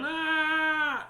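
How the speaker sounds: high-pitched scream of fright; lady's scream. A narrator's reading. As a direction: shriek high as if startled at the door. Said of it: "The lady went".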